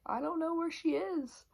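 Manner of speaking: a hammed-up polite stranger who doesn't know; put-on stranger voice. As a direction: pretend to be someone else entirely. Faking amnesia, high put-on voice.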